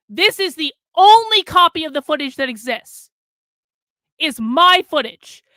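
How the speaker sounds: loud, punching every word at Crowder; angry shout. Real, not acted. Streamer addressing Steven Crowder directly, loud angry callout.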